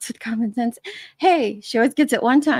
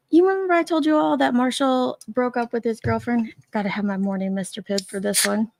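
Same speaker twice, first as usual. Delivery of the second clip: usual speaking voice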